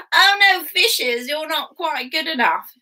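high, loud disdain; mean emperor. Acted. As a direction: look down on the fishes. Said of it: "It's a bit mean".

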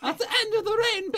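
hammed-up Irish lilt; mock Irish accent. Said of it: "That's Irish".

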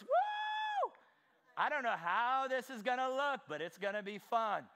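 high shouted whoop then laughing; gleeful shout and laugh; excited whoop. Retold or imitated. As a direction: whoop with glee. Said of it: "what if looks and goes".

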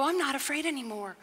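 a calm answer to fear, not acted; calm resolve. Shown as a demonstration. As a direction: say it like quiet faith, no drama. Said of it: "You can look at life and go".

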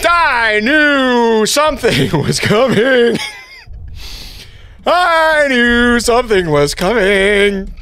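high, slow, laughing through the shock; laughing shakily. Real, not acted. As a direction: still laughing from the fright. After jump scare.

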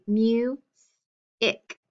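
"mu-ic" slow, split by a pause; long U, pause, short i in "mu-ic"; sounded out in syllables. Shown as a demonstration. Teacher blending syllables for pupils, sounded out. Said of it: "always have a short vowel".

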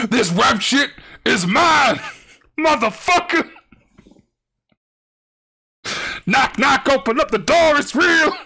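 over-the-top shouted rap bit; hyped rapper voice. Pretend. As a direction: scream it like a rap hype man.

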